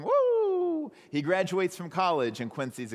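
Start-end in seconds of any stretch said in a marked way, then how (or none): 0.0-0.9 high, loud woo; dog's happy howl; excited dog. Retold or imitated. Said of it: "and Quincy going".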